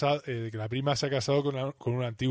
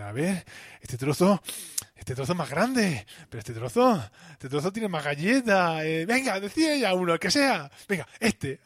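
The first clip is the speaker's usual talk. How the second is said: higher, louder, impatient cake haggler; fussy bargaining; 5 semitones higher, 4 dB louder than usual. Retold or imitated. Dividing cake, pushing him to choose.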